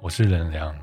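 ordinary talk, nothing marked

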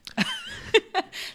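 very high burst of laughter; very high laughing shriek. Real, not acted.

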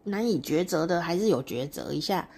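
ordinary delivery

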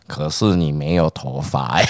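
slow dopey friend. Retold or imitated. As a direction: drawl like a mama's boy recruit. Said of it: "就是讲话很慢".

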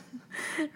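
突然尖起来的一声轻笑; 忍不住笑出一声高音; 高声轻笑. Real, not acted. 自嘲笑出声. (高音短促轻笑)

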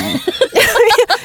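falling high laugh. Real, not acted. Laughs at her own story.

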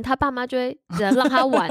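ordinary talk

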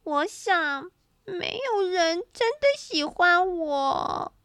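high, slow, tearful wail; child character crying as they speak; crying out loud. A narrator's reading. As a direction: wail it slowly through acted sobs. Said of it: "波利放声哭了起来".

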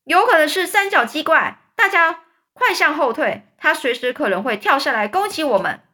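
high loud warning; high panicked shout. A narrator's reading. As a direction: yell the warning. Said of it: "警长大叫说".

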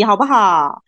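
ordinary talk

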